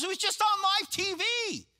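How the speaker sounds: very high-pitched frantic exclamation; imitated screaming alarm. Pastor insisting he saw it on TV, high mock scream. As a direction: mimic a panicked witness, voice shooting up. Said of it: "suddenly he screams into the phone".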